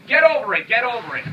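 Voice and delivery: loud sneering echo; mocking shout. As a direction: throw their words back loudly. Mocking what others tell him.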